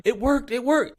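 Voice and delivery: high, loud excited imitation; mimicking someone thrilled with a success; mock excitement. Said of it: "she's all excited".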